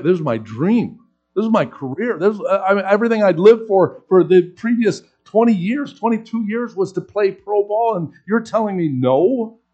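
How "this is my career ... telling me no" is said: rising louder and higher into outraged protest; exasperated disbelief. Retold or imitated. Player protesting to his coach, voice climbing in indignant disbelief. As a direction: pour out the hurt of a dream taken away.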